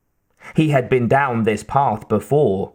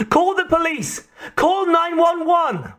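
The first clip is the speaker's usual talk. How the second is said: very high-pitched frantic cries; screaming. A narrator's reading. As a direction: scream high for someone to act. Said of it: "Kate screamed".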